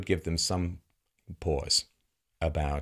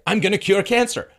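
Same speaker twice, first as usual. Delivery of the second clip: mock insistence; 10 semitones higher, 7 dB louder than usual. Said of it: "And I say".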